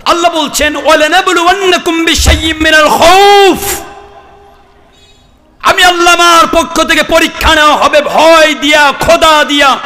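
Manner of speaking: high-pitched, impassioned preacher's cry; full-force preaching. Real, not acted. Preacher proclaiming God's test to the crowd, high shouted preaching. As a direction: drive it out high to a big crowd.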